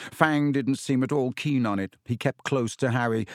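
ordinary delivery

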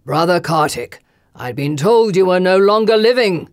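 loud, cold lord calling down; commanding lord. Acted. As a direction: call it across the room. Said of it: "a voice called".

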